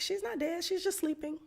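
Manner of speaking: light, high, breezy reassurance. Retold or imitated. Jesus tells Jairus, high and casual. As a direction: toss it off high and easy, no worry. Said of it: "he's so casual about it".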